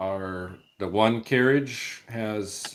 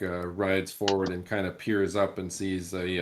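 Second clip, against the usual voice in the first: normal talking voice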